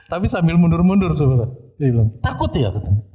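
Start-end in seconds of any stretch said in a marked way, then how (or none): 2.2-2.7 high-pitched mock taunt; teriakan orang kerasukan. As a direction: jeer as the possessed person, pitch jumping up. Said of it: "dia teriak ke". (mengejek dengan nada tinggi, pura-pura kerasukan)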